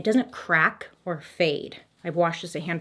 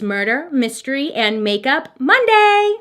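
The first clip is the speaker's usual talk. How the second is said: excited title call; 5 semitones higher, 6 dB louder than usual. Acted. Host announcing show name to viewers, loud and excited. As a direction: sell the show name with a big build.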